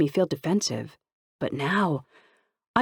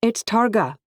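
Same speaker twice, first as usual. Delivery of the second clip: urgent; 7 semitones higher, 4 dB louder than usual. Acted. Calling into gate intercom.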